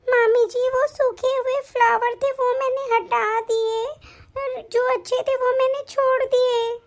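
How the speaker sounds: put-on high, slow little girl explaining; a small girl defending herself, high and slow; childlike voice. Niece explaining the flowers to her aunt in a high childlike voice.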